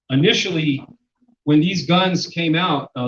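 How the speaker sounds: normal talking voice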